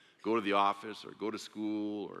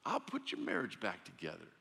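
ordinary talk, nothing marked

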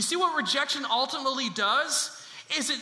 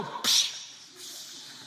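voiceless "psh", drawn out; mimicked smack, hissed through the mouth; slap sound. Shown as a demonstration. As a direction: demonstrate a slap noise for everyone to copy. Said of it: "Everyone just go".

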